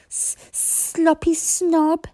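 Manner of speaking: high, slow stuttered dragon jeer; stuttering taunt. A narrator's reading. Toothless taunting Fireworm, high slow stutter. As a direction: call out the insult cheekily. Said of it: "called out".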